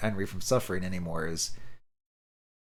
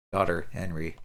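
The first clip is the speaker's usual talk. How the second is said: ordinary delivery, nothing marked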